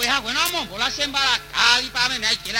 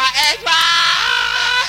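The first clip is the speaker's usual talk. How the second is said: very slow, high, loud ay; drawn out wail; 5 semitones higher, 4 dB louder, much slower than usual. Acted. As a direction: stretch the ay into a long cry. (lamento largo y agudo)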